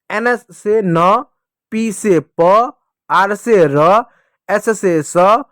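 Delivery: letter name, से, then a stretched sound like न, प, र; slow sing-song pairs, holding न, प, र as "nuh, puh, ruh"; phonics repeat-after-me drill. Shown as a demonstration. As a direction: lead pupils through न, प, स one drawn-out sound at a time. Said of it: "मेरे साथ बोलिएगा".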